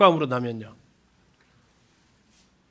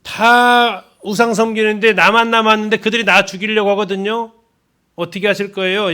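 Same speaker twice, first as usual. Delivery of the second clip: higher, louder complaining plea; whining Elijah; 4 semitones higher, 5 dB louder than usual. Retold or imitated. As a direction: sulk and plead like a self-pitying prophet. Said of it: "예, 그 투예요".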